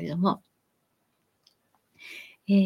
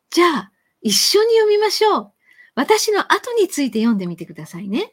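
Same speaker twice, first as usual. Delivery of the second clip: teacher inviting; 6 semitones higher, 5 dB louder than usual. Retold or imitated. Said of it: "っていう感じ".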